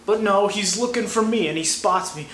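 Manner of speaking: ordinary talk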